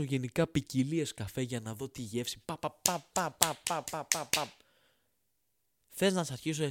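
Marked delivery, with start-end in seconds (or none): 2.4-4.5 drawn-out pitying tut-tut; mock tutting. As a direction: scoff at him with mock pity. Mocking tut-tut at the snob.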